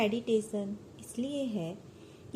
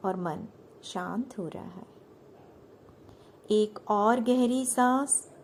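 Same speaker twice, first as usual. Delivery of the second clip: measured, soothing meditation guide; unhurried yoga nidra instruction; guided meditation; 9 dB louder than usual. Acted. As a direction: lead them into relaxation with long pauses between phrases.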